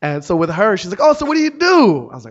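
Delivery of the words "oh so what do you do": high, loud mock-chirpy question; excited nosy stranger. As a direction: pounce on them with gushing curiosity. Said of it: "curious about everything. She was excited".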